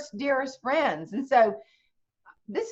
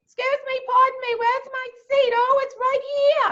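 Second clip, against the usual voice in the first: much higher, louder, pushy New York woman; obnoxious New York Yankee; 6 dB louder than usual. Retold or imitated. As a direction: play the loud Yankee barging onto the plane. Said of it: "this obnoxious Yankee got on there".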